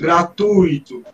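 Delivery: gratuito said slowly, stress on tui, the i fading; showing the falling diphthong in gratuito; slow stress demo. Shown as a demonstration. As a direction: drag out gratuito so the i trails off. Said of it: "o I vai diminuir".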